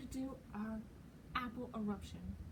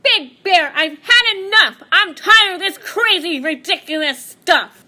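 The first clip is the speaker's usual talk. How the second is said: high, loud exasperated yell; the wind fed up with Bear; loud, high shout of the wind; 8 semitones higher, 15 dB louder than usual. Acted. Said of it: "She yelled".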